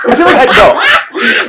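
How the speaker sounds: high laughing protest. Real, not acted. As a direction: squeal through a laugh.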